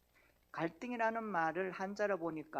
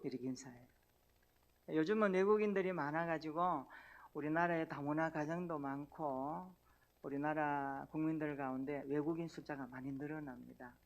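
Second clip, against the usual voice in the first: usual speaking voice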